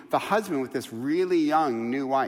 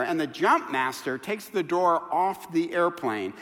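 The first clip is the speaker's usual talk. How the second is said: ordinary delivery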